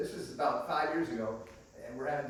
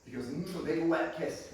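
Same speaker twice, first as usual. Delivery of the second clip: normal talking voice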